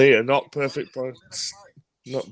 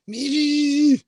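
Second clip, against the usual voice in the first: imitating squeak; 13 semitones higher than usual. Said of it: "really loudly".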